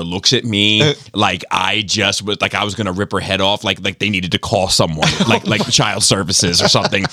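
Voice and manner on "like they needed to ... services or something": ordinary talk, nothing marked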